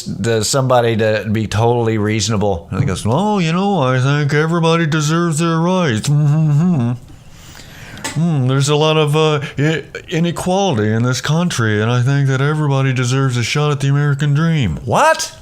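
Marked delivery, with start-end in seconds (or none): 3.0-14.8 put-on smug, relaxed wealthy old man voice; rich guy voice. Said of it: "the rich guy voice". 14.8-15.4 high, stunned outburst of disbelief; shocked disbelief. Retold or imitated. Shocked by the rich guy.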